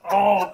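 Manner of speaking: loud, abrupt mock roar; imitated monster roar. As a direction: let out a sudden creature cry. Imitates monster turning on other man.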